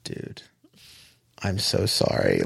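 low, hushed commiseration; sympathetic apology. Retold or imitated. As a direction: quote a sorry employee softly and low. Phone staff commiserating with the caller.

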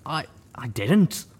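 high, slow, halting stammer. A narrator's reading. Nervous companion stammering his denial to the wounded heroine. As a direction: stumble over it, nervous and caught out. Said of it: "he stammered".

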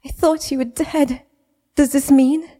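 rising towards a hopeful question; tearful disbelief. Acted. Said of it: "through aching sobs".

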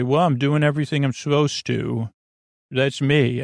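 usual speaking voice

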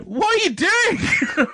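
high, drawn-out mock outrage; imitated exasperated yell. Sal to stranger in the van, exasperated yell. Sal berating man who got in.

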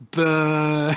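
mock crowd jeer, drawn out; playful booing. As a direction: boo her in jest. Playfully jeering at blamed wife.

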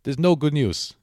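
high, loud Romanian builder impression; Romanian accent. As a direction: break it comically in a Romanian accent. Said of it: "is that a Romanian accent".

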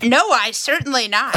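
normal talking voice

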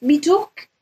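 slightly Liverpudlian-sounding Stoke accent. Shown as a demonstration. Puzzling over Stoke speech, in an imitated Stoke accent. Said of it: "it's done in a weird accent".

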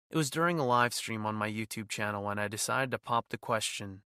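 usual speaking voice

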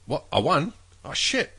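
rising, baffled waking up; confused surprise. Retold or imitated. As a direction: act dazed and shocked.

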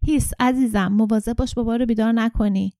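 ordinary delivery

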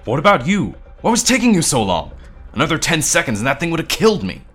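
high, loud, heated tirade; furious shouted blame; angry accusation. A narrator's reading. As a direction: lay into them, speeding up at the end. Noah's angry accusation in quarrel.

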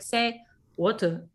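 put-on slow British-accented prompt, R dropped. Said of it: "she would pronounce it in the accent".

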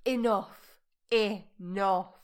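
loud, emphatic mock fury; exasperated outburst. Driver shutting down passenger's correction, retold loud exasperation. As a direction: put your foot down loudly, word by word.

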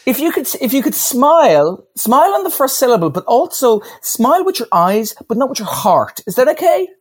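high, hammed-up direction voice; mock director notes. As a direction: send up a pretentious director. Mock director giving silly notes.

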